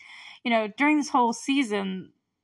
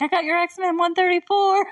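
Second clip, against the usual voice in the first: put-on calling out kid; 9 semitones higher, 6 dB louder than usual. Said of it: "Dustin's like".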